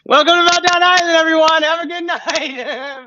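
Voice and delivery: very high, loud hammed-up sign-off; mock meltdown. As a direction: play a comic meltdown while saying goodnight, stretching the end.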